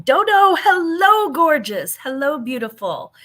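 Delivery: a bright, high hello to a friend; high, loud, gushing hello; fond greeting. Real, not acted. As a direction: greet her like you're thrilled to see her. Greeting a chat viewer fondly.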